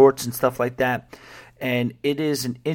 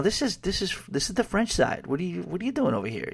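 higher, softer, quick pointed question; a light hint you're not welcome here; French side local; 6 semitones higher, 6 dB quieter, faster than usual. Retold or imitated. French cabbie questions a Dutch driver, higher, softer and quick. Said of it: "the French side guy will be like".

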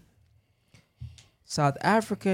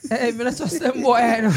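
higher playful Jamaican patois voice; mock Jamaican patois; 5 semitones higher, 4 dB louder than usual. Teasing Nigerian friends about naked showers, higher mock Jamaican patois. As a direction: joke in a put-on Jamaican accent.

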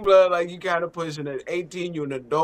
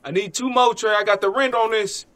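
higher-pitched imitation of a fan calling out; courtside heckler; 6 semitones higher than usual. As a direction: plead with the player like a bettor in the crowd. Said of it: "in the crowd say".

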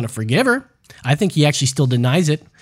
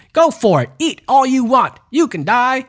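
mock encouragement; 7 semitones higher, 3 dB louder than usual. Mocking body positivity folks.

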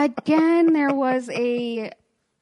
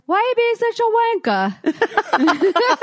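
higher, loud mock British insult; British accent; 10 semitones higher, 4 dB louder than usual. Drunk [name] insulting the rude guy in a British accent. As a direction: spit out wanker like a Brit. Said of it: "with a British accent".

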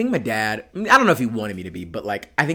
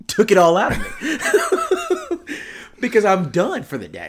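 laughing excuse; 9 semitones higher, 4 dB louder than usual. Retold or imitated. His own excuse, laughing.

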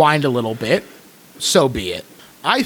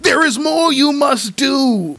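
monk interrupting; 11 semitones higher, slower than usual. Acted. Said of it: "the monk breaks in".

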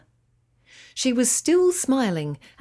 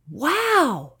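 higher, delighted little wow; excited cry; 6 semitones higher than usual. A narrator's reading. Emma sees the tiny turtles, excited cry. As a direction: burst out with childlike delight. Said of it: "cried Emma".

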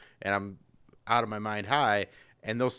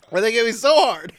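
laughing; 9 semitones higher, 8 dB louder than usual. Real, not acted. Laughing fit over hiccups.

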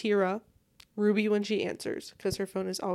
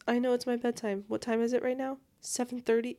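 ordinary talk, nothing marked

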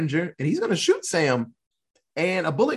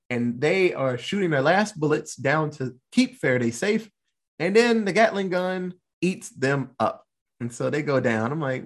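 usual speaking voice

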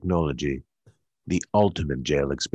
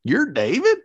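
shocked disbelief; 4 dB louder than usual. Acted. Echoed shock at meeting David.

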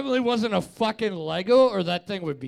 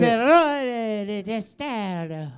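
hammed-up Spanish accent; 3 semitones higher, 4 dB louder than usual. Co-host takes over the hard foreign name, hammed-up Spanish accent. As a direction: lay on an exaggerated Spanish accent. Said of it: "you go into your Spanish".

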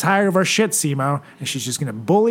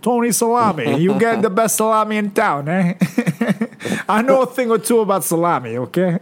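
Italian pizza guy; 7 semitones higher than usual. Pretend. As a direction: play a boastful pizza owner. Playing pizza parlor owner Tony.

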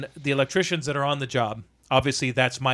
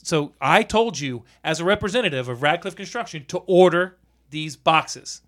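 deliberate reprimand; 3 dB louder than usual. Retold or imitated. Company representative telling electricians to order the boxes, deliberate reprimand. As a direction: spell it out firmly, phrase by phrase.

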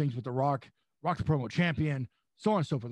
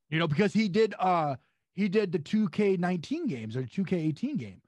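ordinary talk, nothing marked

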